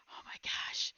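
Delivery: whispered mock gasp; imitated hushed disbelief. Husband relieved of the virus, hushed whisper.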